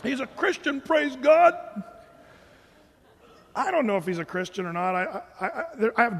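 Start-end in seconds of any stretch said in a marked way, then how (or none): 0.0-1.5 high, loud, gushing praise-God voice; mock praise. Pastor mocking fervent voters, high and loud. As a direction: ham up a fervent believer.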